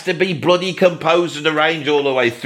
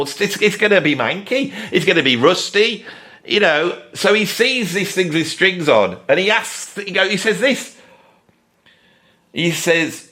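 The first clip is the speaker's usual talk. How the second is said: ordinary talk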